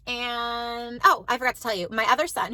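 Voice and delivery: normal talking voice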